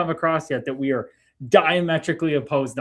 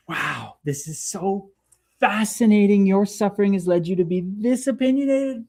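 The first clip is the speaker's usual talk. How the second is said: mock fascination; 7 semitones higher than usual. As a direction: marvel at them, drawing the words out. Acts out detached fascination at critic.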